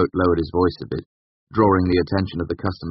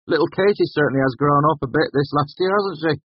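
higher-pitched villager thinking aloud; musing; 10 semitones higher, 4 dB louder than usual. A narrator's reading. Graham to the others at the bar, musing. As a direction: muse on it idly. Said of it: "Graham mused".

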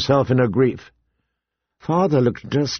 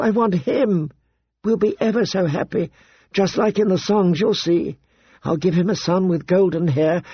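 put-on higher, wistful girlish voice; dreamy girl; 6 semitones higher than usual. Daughter telling father of her royal dreams, higher and dreamy. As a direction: dream aloud of the future like a starry-eyed girl.

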